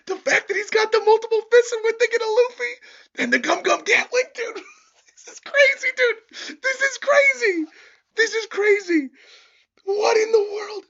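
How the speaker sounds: very high, loud, shrieking excitement; hyped disbelief. Real, not acted. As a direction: shriek like a fan losing it. Fan freaking out over attack.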